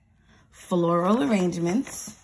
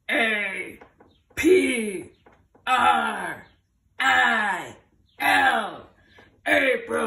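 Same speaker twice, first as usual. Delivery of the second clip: monster voice; 5 dB louder, much slower than usual. Shown as a demonstration. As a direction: growl each letter like a monster. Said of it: "spell it in our monster voice".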